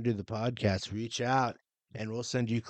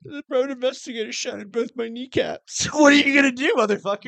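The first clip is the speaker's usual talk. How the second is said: high, loud mock rant with a laugh; mock shout; 12 semitones higher, 10 dB louder than usual. A robbed thief ranting loudly to the police. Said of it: "Go to the police and say".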